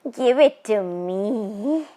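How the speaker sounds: a tall witch toying with her prey; ghost woman. Acted.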